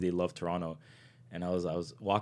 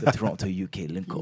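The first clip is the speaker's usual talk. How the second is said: mock London accent, amused; playful put-on London accent; imitated London accent. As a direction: echo a London fan's catchphrase in his accent. Repeats London fan's catchphrase again.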